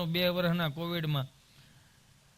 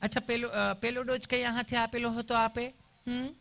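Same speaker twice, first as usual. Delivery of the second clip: higher-pitched, cooing nurse voice; sweetly caring nurse; 5 semitones higher than usual. Acted. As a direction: mimic an over-sweet nurse. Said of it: "તમે કેટલું પ્રેમથી પૂછો છો".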